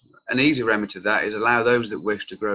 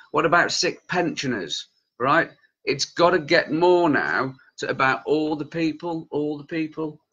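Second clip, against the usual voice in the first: raised pitch, worked-up insistence; angry, passionate; 4 semitones higher than usual. Real, not acted. Campaigner sticking up for sick people of every age, getting angry. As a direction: get heated and press each point home. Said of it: "Sorry, I'm getting angry".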